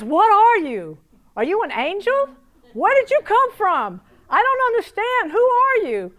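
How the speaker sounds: high, loud, frantic demands; astonished shouting. Retold or imitated. Man bursting in to question the woman, astonished shouting. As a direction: fire off bewildered questions at full voice.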